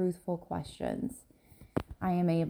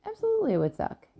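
much higher, fast and punchy; loud, emphatic Absolutely; 3 dB louder, faster than usual. Real, not acted. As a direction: fling the word Absolutely up high and quick. Answering her own question.

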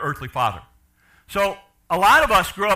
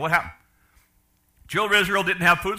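usual speaking voice

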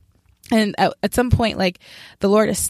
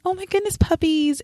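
mock sweet girly gush; 10 semitones higher than usual. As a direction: coo it like a sugary sweet girl. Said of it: "I'm not the kind of girl that's like".

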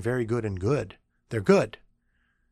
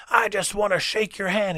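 high-pitched, emphatic greeting; loudly eager, pitched up; emphatic professor; 9 semitones higher, 4 dB louder than usual. Retold or imitated. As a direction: imitate an over-passionate professor rushing up. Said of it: "comes walking towards me emphatically".

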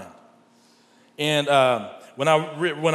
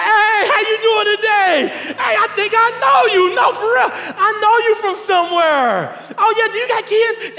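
loud, high, laughing small talk with a stranger; bubbly shouting hello in the grocery line; friendly chatter; 13 semitones higher, 4 dB louder than usual. Retold or imitated. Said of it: "I'd be like".

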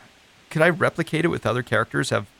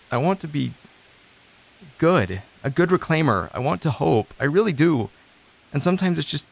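pleading, pitched up and louder, voice close to breaking; fighting back tears while admitting you want to hope; near tears; 5 semitones higher, 4 dB louder than usual. Acted. Client confessing to advocate he wants to hope, near tears.

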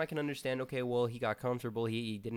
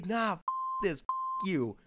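loud, high-pitched acted defiance; defiant outburst; 7 semitones higher, 5 dB louder than usual. Host voicing what they gotta say, loud defiance. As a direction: throw it out like telling off the boss. Acts out defying the manager.

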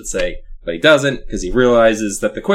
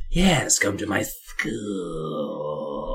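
slow, low, drawn-out creepy invite; a slow sinister doctor's invitation; 6 semitones lower, 7 dB quieter, much slower than usual. Retold or imitated. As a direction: lure the kid in slowly.

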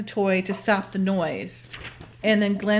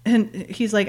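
normal talking voice

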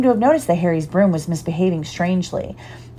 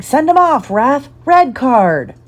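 much higher, louder furious yell; 7 semitones higher, 5 dB louder, slower than usual. A narrator's reading. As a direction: yell at the ref from the stands. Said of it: "Dean Thomas was yelling".

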